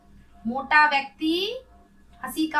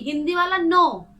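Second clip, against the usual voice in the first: normal talking voice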